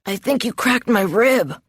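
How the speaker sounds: strained, hurting voice, understated; pained moan. A narrator's reading. Injured man accusing his sparring partner, mildly pained. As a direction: say it through a mild moan. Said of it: "he moans".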